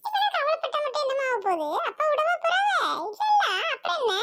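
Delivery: high-pitched, insistent brush-off; dismissive protest. Acted. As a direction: argue it away in a high voice. Son brushing off the family's worry. (உயர்ந்த குரலில் அலட்சியமான வாதம்)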